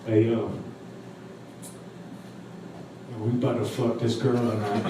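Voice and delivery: low, smooth and cool; casual proposition in a lightly imitated rapper voice. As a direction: say it low and laid-back. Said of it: "real smooth, real cool".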